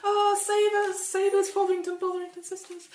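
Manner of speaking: high panicked cries for help; screaming to get out. Acted. Family trapped in the burning church, high mock panic. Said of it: "so they're screaming to get out".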